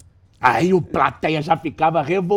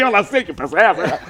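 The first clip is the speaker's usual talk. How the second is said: laughing; 7 semitones higher, 4 dB louder than usual. Retold or imitated. Laughing at own reply.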